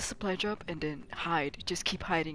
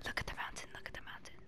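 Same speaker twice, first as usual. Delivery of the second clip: soft urgent whisper; hushed whisper; 9 dB quieter, faster than usual. Real, not acted. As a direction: point quietly. Whispering to teammate.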